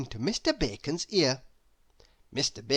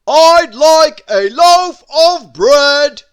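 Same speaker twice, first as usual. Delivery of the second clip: slow, high, very loud boom; 9 semitones higher, 16 dB louder, slower than usual. Acted. Mister Noisy ordering bread from the baker, a slow booming bellow. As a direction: bellow it out slowly. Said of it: "boomed Mister Noisy".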